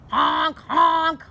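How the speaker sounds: loud, high honk honk like car horns. Acted.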